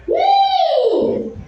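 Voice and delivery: high, loud shrieking woo; party whoop. Pretend. Comic to the crowd, a high loud party whoop.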